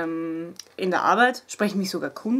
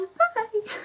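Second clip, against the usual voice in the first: Bye rausquietschen, sehr hoch; gequietscht; 13 semitones higher than usual. Real, not acted. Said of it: "Jetzt hab ich gequietscht". (quietschiges Bye)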